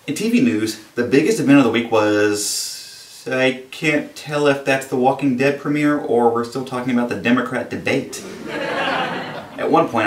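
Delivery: usual speaking voice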